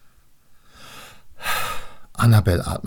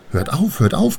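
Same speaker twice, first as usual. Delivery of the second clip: high, teasing echo; mocking echo; 9 semitones higher than usual. Rhine daughters echo Annabel from the water, mocking. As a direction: mimic the protest in a high voice.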